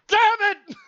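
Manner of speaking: very high, loud yell; frustrated yell. Real, not acted. Vlogger cracking under pressure, frustrated yell. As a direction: scream it out.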